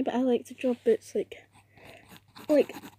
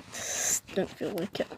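slow, soft unvoiced whisper; 5 semitones lower, 7 dB quieter than usual. Real, not acted. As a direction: breathe it out lazily. Aside while drawing.

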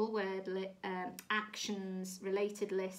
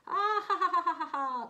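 high, very loud acted guffaw; hammed-up cartoon laughing; mocking crowd laughter; 9 semitones higher, 8 dB louder, faster than usual. A narrator's reading. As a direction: belt out separate ha-ha bursts. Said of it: "laughed all the other animals".

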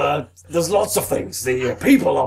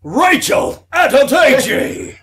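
slow, high, loud yell of the name; sudden slow bellow; shouted name; 9 semitones higher, 5 dB louder, slower than usual. Acted. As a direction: bellow it out of nowhere. Blurts a contestant's name.

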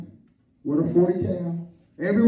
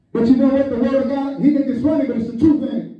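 ordinary delivery

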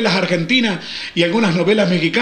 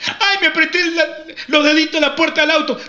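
put-on high, whining hurt child; child whining about a scrape; whiny child; 7 semitones higher than usual. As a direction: whimper to papa like a hurt kid. Child complaining of pinched fingers.

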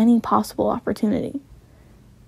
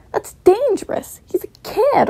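much higher alarmed protest; 11 semitones higher than usual. Acted. Son protesting, high and alarmed, to his mother. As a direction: squeak it out in panic.

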